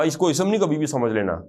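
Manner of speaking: ordinary delivery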